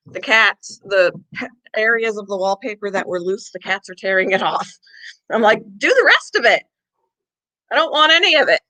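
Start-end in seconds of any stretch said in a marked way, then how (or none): 5.8-8.6 mock scolding. Telling cats off, retold.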